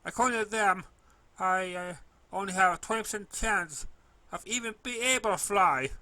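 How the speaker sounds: high, loud, shaky voice of a sad filly; tearful filly. A narrator's reading. Filly telling the pony the doctor's odds, high loud tearful. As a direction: say it high and loud, still upset.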